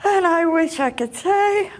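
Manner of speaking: ordinary talk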